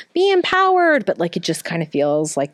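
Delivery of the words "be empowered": mocking chirpy instructor. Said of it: "they're like".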